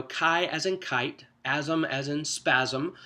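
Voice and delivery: "chi" said like the start of "kite", "asm" rhymed with "spasm", slowly; spelling out chiasm. Shown as a demonstration. Teacher showing the group how to say chiasm, slow and careful. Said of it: "they refer to that as chiasm".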